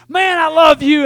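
big hearty mock declaration; gushing greeting. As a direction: call out love heartily, imitating a dad. Retold gushing greeting from his dad.

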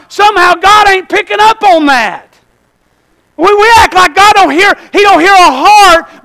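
high, loud, insistent pulpit voice; full-force preaching. Real, not acted. Preacher scolding churchgoers for saying what they don't mean, full-force preaching. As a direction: drive it home high and hard.

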